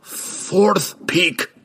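high, strained dwarf's cry; screamed reply. A narrator's reading. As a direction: scream it back from delirium. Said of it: "Bruner screamed back".

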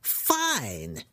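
restrained, drawn-out outburst; sudden scream. Acted. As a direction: burst out in exasperation without full volume. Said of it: "he screamed suddenly".

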